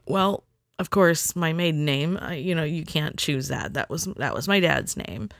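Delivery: usual speaking voice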